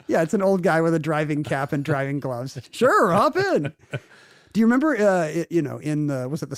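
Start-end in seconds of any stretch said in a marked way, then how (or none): 2.7-3.7 high, slow mock old-timer; hammed-up cheerful old driver offering a ride. Old driver voiced in story.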